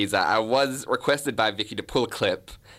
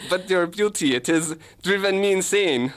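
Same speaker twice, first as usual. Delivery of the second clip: put-on higher, hammy German-accented wooing; German detective; 5 semitones higher than usual. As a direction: declare mock passion in bad German accent. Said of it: "I'll play the German detective".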